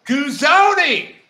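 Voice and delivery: high, drawn-out Texan twang; mock Texas accent. Co-host echoes the Texan pronunciation joke, mock Texas accent. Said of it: "down here in Texas".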